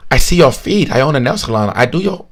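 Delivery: coaxing offer in an imitated Vietnamese accent. Salon owner offering a free pedicure, mock Vietnamese accent. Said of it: "thick, thick Vietnamese accent".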